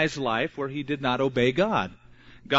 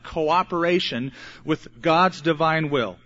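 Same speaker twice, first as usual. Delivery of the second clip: usual speaking voice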